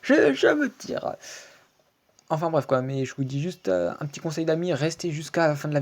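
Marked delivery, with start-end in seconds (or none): 0.0-1.2 very high, slow comic squeal; drawn-out high mock voice; fleeing wizard. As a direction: squeak it out as a tiny escaping character.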